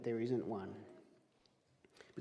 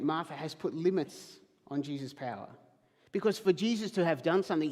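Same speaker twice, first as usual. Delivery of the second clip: ordinary talk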